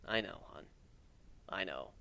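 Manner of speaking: soft, low murmur. Acted. Warren murmuring fondly to his wife, soft and low. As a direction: reassure his wife gently. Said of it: "he murmured".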